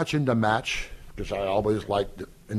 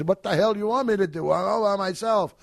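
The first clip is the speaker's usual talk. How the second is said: exasperated boss; 10 semitones higher than usual. Retold or imitated. Boss complaining he works alone.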